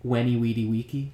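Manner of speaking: WENI WEEDY WIKI with every V turned to W; Latin pronunciation. Shown as a demonstration. Host offering a famous quote, Latin pronunciation. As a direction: say WENI WEEDY WIKI, all W sounds and a hard K. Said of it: "V's are pronounced as W's".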